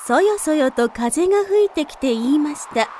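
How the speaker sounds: usual speaking voice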